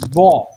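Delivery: वो said loud and full, a heavy Arabic letter sound; letter demo. Shown as a demonstration. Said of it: "next पढ़िए". (ज़ोर से हर्फ़ की आवाज़)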